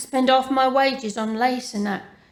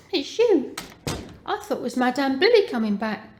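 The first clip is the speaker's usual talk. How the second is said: ordinary delivery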